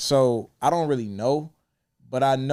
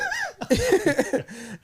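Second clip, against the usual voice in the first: high burst of laughter. Real, not acted. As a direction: crack up at the memory. After quoting father.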